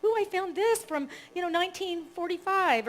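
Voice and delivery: hammed-up thrilled old lady; delighted volunteer. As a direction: squeal like a kid finding treasure. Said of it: "And she's like".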